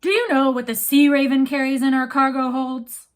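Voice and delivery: loud booming captain bellow; captain bellowing to his guests about the rival ship. Acted. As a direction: bellow it across the cabin. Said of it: "Captain Swain bellowed".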